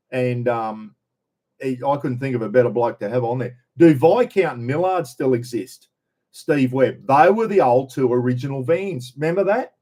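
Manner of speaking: normal talking voice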